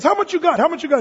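imitated haggler pressing for cash; pushy shopkeeper. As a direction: press them for the money. Acts shopkeeper pressing for money.

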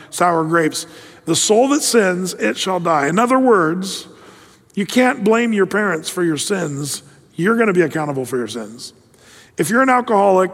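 ordinary delivery

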